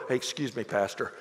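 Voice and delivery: hushed courtesy to the pastor; polite aside. Retold or imitated. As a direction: duck out politely before yelling. Alan politely excusing himself.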